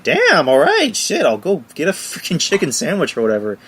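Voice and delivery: high-pitched mock exasperation; exasperated giving in. Scolded boyfriend gives in to go eat, exasperated. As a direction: jump up high on "Damn", then grudgingly relent.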